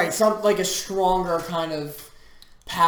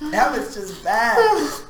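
slow, drawn-out judgement through a laugh; laughing verdict; 3 semitones higher, slower than usual. Real, not acted. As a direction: crack up while panning it. Laughing at friend's terrible video.

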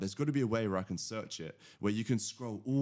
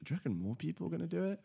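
higher, eager dawning realization; sparked excitement, pitched up; sudden idea; 8 semitones higher than usual. Retold or imitated. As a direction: catch the idea mid-thought, lifting up. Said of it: "I was like".

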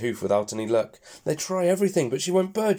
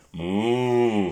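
cow moo; 4 dB louder than usual. Acted.

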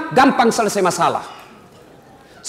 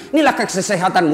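ordinary talk

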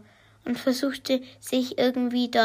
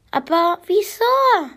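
hohe, laute Kinderstimme, die „wieso“ fallend quengelt; quengelndes Kind; 8 semitones higher, 6 dB louder than usual. A narrator's reading. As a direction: wie ein enttäuschtes Kind nachhaken. Kind protestiert enttäuscht. (Mara fragt Mama enttäuscht nach dem Grund: quengelnd, hoch und laut)

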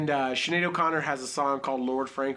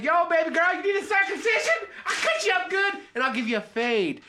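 excited high-pitched pitchman bit; hawking barber; 13 semitones higher than usual. Acted. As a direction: shout it like an overeager barber hustling a customer. Acts barber pitching surgery to customer.

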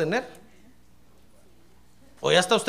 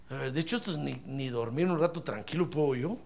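grumbling; 6 semitones lower, 8 dB quieter than usual. Retold or imitated. Annoyed Jesus woken from sleep.

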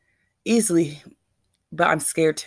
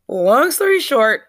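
slow, loud and higher, each word stretched; drawn out; 7 semitones higher, 6 dB louder, slower than usual. Shown as a demonstration. Woman telling her followers about the casino night, slow, loud and drawn out. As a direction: stretch it out like a punchline before correcting yourself. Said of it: "not even long story short".

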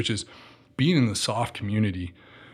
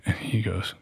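ordinary talk, nothing marked

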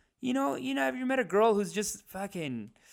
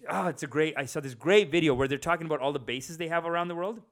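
ordinary delivery

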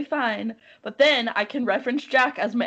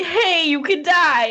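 loud, high, drawn-out mock alarm; slow, hammed-up shout of warning; retold urgent warning; 8 semitones higher, 8 dB louder, slower than usual. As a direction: imitate a doctor calling out a warning. Retold doctor's warning, acted loudly.

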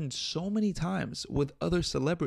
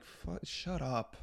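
muttered annoyance; 4 semitones higher, 10 dB quieter, slower than usual. Retold or imitated. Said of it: "I'm like".

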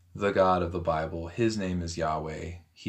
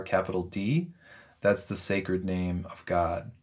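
ordinary delivery

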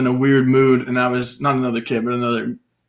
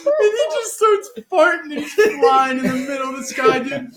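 high, squeaky telling through real laughs; real giggling fit mid-story; helpless laughter; slower than usual. Real, not acted. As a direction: struggle to speak while cracking up. Cracks up at absurd dream.

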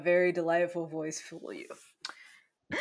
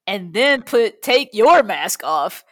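loud; 9 dB louder than usual. Pretend. Confused safety demo, louder.

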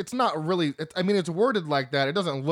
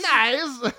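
high, loud Borat "nice"; Borat impression; 9 semitones higher, 5 dB louder than usual. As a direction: do Borat's exclaimed catchphrase. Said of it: "I'm still doing Borat".

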